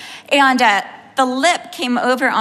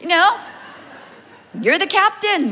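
laughing explanation; 6 semitones higher than usual. Retold or imitated. Wife laughingly telling husband he was the intruder. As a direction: explain it while cracking up. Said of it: "I'm like".

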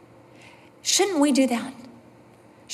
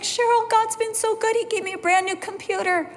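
lightly voiced happy friend, higher; a hint of a gushing friend; 11 semitones higher than usual. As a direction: gush like a thankful friend. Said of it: "she's like".

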